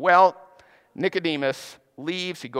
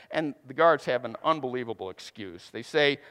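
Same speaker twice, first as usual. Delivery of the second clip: ordinary talk, nothing marked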